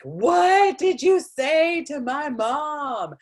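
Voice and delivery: slow, high, loud indignant demand; hammed-up accusing wail; acted outraged confrontation. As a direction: stretch each word in mock outrage.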